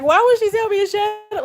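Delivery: high, mocking imitation of a whiner; mock complaint. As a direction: mimic a hater in a high voice. Mimics complaining haters.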